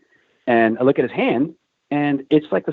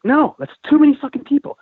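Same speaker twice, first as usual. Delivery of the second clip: high, louder-pitched protest; retold exasperated disbelief; 4 dB louder than usual. Retold disbelief after second headcount.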